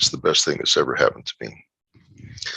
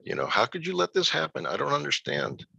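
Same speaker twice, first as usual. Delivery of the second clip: mildly angry pleading, pitched up; retold anger at God; 8 semitones higher than usual. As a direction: question God in pained disbelief. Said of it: "I was just angry with God".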